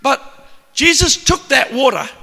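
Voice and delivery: normal talking voice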